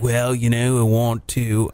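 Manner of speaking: low, put-on British voice; half-hearted British accent; vague British accent. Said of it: "I don't know what fucking accent I'm doing".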